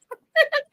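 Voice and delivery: squealing high laugh; high giggle. Real, not acted. After hang-up game.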